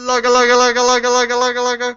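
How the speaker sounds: very loud, high, chanted holler; rallying call. Shown as a demonstration. Asked for best rendition of the Logalog call, loud chant. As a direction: belt out a rapid, unbroken rallying cry. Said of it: "please give us your best rendition".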